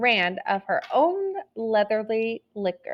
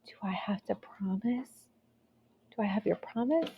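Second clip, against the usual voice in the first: soft unvoiced whisper, fast on the second line; near whisper; 10 dB quieter than usual. A narrator's reading. The gingerbread girl asks the fox for safe ride, whispered. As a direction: breathe the question out scared, barely voiced. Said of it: "sang in a nearly whisper".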